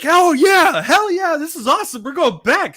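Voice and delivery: high, loud, giddy voice of an eager fool. Retold or imitated. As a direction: squeal it like an overexcited kid. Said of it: "Deep's like".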